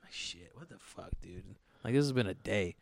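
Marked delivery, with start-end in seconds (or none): 0.0-1.4 whispered dread. Retold or imitated. Dreading another neighbor visit.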